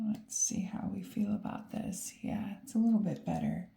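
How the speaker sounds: soft unvoiced whisper to herself. Real, not acted. Reader whispering to herself over the cards. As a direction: whisper it while checking the cards.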